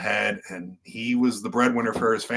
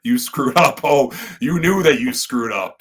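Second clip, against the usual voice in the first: mock scolding parent; 4 semitones higher, 6 dB louder than usual.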